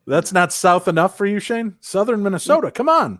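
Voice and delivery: high-pitched playful protest; mock indignation. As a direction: plead the case in mock offense.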